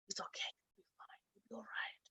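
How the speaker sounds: very soft, soothing whisper; whispered reassurance. Retold or imitated. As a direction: whisper comfort as if to a child. Voicing whispered reassurance to a child.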